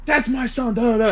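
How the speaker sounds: high-pitched imitation of a protesting mom; indignant mom. Mom insisting that's her son, mock indignant. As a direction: mimic her outraged insistence, well above your voice.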